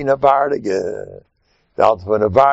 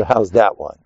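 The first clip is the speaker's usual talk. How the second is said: ordinary delivery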